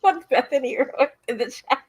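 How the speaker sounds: amused apology through giggles; laughing. Real, not acted. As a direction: talk while trying to stop laughing. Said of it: "Sorry, I'm laughing at what".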